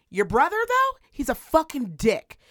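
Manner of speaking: high, loud sudden jab at the brother; mock outburst. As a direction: blurt it out like a catty aside. Mocking the kid's brother.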